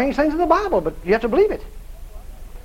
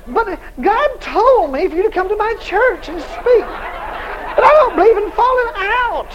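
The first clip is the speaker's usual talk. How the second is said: laughing mimicry; 9 semitones higher, slower than usual. Mocking the stubborn Pentecostal preacher.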